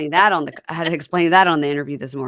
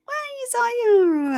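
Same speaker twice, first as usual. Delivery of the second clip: high, drawn-out hedge; mock reluctant stalling; 4 dB louder than usual. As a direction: stretch it out high, dreading the admission. Acting Laura stalling before confessing.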